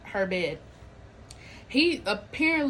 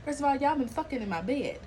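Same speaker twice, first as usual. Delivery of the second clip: ordinary talk, nothing marked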